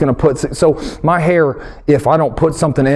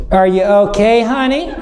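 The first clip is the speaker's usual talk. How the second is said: higher, doting voice, lightly put on; fussing wife; 6 semitones higher than usual. Wife Whitney fussing over her hurt husband, lightly mocking doting voice. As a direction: coo over him like a worried wife. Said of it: "She goes".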